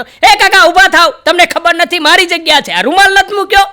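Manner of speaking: high, loud bossy yell; rude shout. Retold or imitated. College boy shouts at an uncle on the bus, loud and high. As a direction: push the old man out of the seat.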